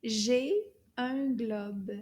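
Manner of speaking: slow, word-by-word French modelling; drawn-out words with gaps between; slow teacher model. Shown as a demonstration. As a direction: say it slowly for learners to repeat. Teacher models sentence for repeating.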